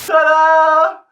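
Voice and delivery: high, loud, showy ta-da; hammed-up cheerful reveal; mock fanfare. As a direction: announce it like a magic trick. Battery died, mock fanfare.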